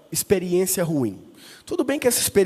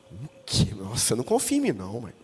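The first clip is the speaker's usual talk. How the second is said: mock innocence; 9 semitones lower, 6 dB quieter than usual. Son feigning innocence to mother.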